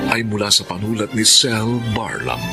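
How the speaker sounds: ordinary delivery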